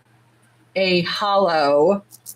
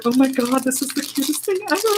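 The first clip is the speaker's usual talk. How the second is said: gushing; 6 semitones higher, faster than usual. Retold or imitated. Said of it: "I was like".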